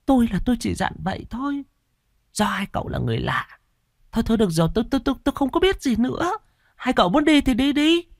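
landlady cutting the talk short, flustered and high. Acted. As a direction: babble it out nervously and wave them away.

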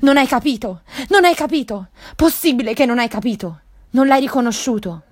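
horrified desperate shout; shocked yell. Acted. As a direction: cry out as if struck by lightning. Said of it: "Mac urlò come folgorato".

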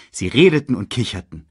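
ordinary delivery, nothing marked

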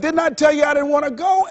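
heart shouting. Acted. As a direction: yell it like the heart finally snaps. The heart yells at worker.

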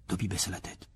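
ordinary talk, nothing marked

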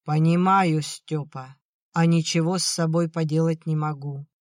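ordinary delivery

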